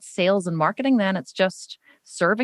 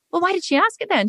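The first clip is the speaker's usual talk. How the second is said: baffled question; 10 semitones higher than usual. Retold or imitated. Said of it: "And I went".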